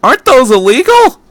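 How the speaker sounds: high, loud shocked question; boy outraged at the danger; dumbstruck disbelief. Acted. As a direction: blurt it out in alarm.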